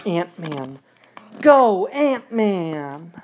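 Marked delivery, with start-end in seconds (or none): 1.4-3.1 drawn-out cheer, a bit louder; slow cheer. Acted. Dad reading to his son, drawn-out cheer. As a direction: stretch out the cheer for the hero.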